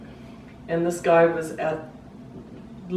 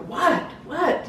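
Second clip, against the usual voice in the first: high, startled mimicked questioning; retold baffled disbelief; confused; 11 semitones higher than usual. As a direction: imitate a bewildered man, pitch jumping up. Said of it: "He's very confused".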